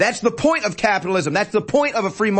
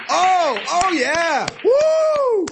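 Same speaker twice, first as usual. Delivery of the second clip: high sarcastic whoop; hammed-up fake celebration; mock cheering; 10 semitones higher, much slower than usual. Mocking cheering crowd.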